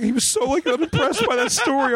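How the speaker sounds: high mock-impressed sarcasm; sarcastic punchline, voice way up. Real, not acted. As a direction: sell it with mock awe. Sarcastic punchline about unimpressed reaction.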